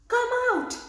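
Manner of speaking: high, loud shout; loud cry. A narrator's reading. Siddi Ahmed commanding the genie, loud cry. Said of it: "cried Siddi Ahmed in a loud voice".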